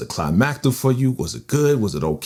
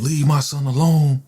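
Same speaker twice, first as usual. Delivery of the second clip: higher, half-acted scream at the son's attacker; retold scream; 6 semitones higher than usual. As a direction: cry it out like a mother pleading for her son. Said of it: "She's screaming".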